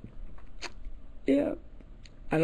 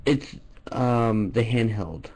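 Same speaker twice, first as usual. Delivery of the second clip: deep mock-shy caller voice; low put-on voice; 8 semitones lower than usual. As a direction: drop the voice low and play embarrassed.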